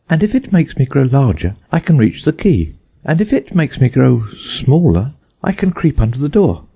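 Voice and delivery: put-on high, eager girl's voice; Alice reasoning. A narrator's reading. Alice planning both outcomes, high and eager. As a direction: reason it out brightly.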